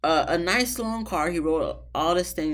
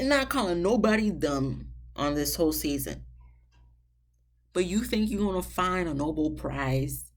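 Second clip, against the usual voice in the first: ordinary delivery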